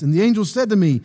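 ordinary talk

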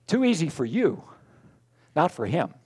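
ordinary talk

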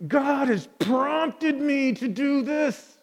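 high, slow, grand declaration; bold proclamation. Acted. Preacher at home announcing God's prompting, boldly proclaimed.

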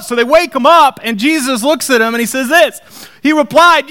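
loud, high preaching build; full-force preacher retelling the storm. Real, not acted. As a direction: drive the story home to the congregation.

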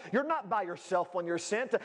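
ordinary delivery

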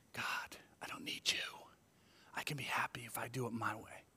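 breathy whisper of a selfish thought; whispered temptation. Acted. Pastor voicing the heart that turns from God, whispering. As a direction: hush it like a secret wish.

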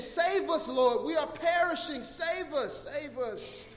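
high, loud cry for rescue; acted-out desperate shout; panicked plea. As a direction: plead urgently as a drowning disciple. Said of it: "The disciples are in utter panic".

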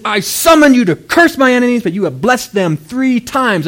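loud, high, emphatic complaint; acted exasperation, pounding the key words; frustrated king. King scolds the prophet he summoned, frustrated. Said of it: "he was so frustrated".

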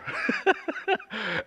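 laughing high at own story; a real, high laugh; high burst of laughter. Real, not acted.

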